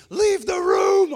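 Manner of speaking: very high, drawn-out scream; furious yell. Retold or imitated. Labouring woman ordering a would-be helper out, furious yell. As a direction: scream it, stretching every word.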